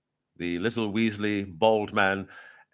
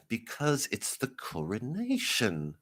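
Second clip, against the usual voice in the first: slow, softer, drawn-out rebuff; condescending official; 7 dB quieter, slower than usual. Acted. Official explaining to the mourner, slow condescension. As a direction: spell it out slowly and quietly. Acted official answering the protester.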